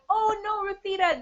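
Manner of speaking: high, loud "oh no"; sympathetic dismay. Real, not acted. Coach sees a viewer waited for the wrong thing, dismayed. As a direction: exclaim in friendly dismay. Reacts to viewer's mistaken wait.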